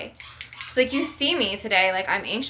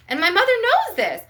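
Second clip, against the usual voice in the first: outburst; 12 semitones higher, 6 dB louder than usual. Real, not acted. Venting about mother.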